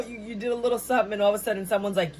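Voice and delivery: ordinary talk, nothing marked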